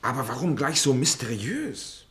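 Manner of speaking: sceptical challenge. Acted. Challenging Michaelis about his mysterious memory, sceptical. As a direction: press the question in mock puzzlement.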